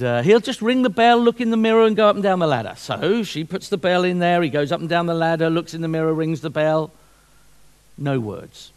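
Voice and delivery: usual speaking voice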